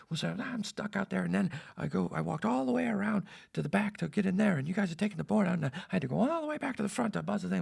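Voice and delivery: high, aggrieved mock tirade; screaming rant. Locked-out host recounts walking around the building, screaming. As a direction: keep complaining in a strained high imitation. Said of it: "And he keeps screaming".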